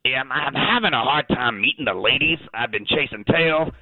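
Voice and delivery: casual Australian talking dog; chatty Aussie caller, thick Australian accent. Acted.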